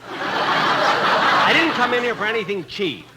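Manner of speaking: indignant. Acted. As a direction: bristle with wounded pride. Indignant at cheaper offer.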